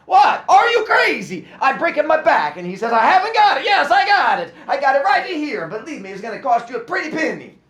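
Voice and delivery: put-on very high, loud exasperated yell in a thick accent. A narrator's reading. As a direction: yell at the caller, outraged. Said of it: "he's yelling at me".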